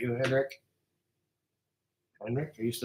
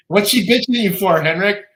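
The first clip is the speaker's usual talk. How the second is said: louder, higher call across the room; 5 semitones higher, 8 dB louder than usual. Real, not acted. Calling across to Henrik during his Swedish phone call, louder. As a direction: raise the voice to reach Henrik.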